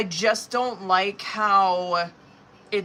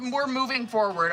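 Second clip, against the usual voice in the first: normal talking voice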